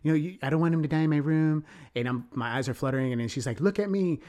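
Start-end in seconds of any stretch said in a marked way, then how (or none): 0.0-1.6 higher, louder mock panic; mildly hammed-up worry; freaking out. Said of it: "she's freaking out". 3.6-4.2 high, raised-voice urgent command; mock-frantic order; freaking out. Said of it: "she's freaking out".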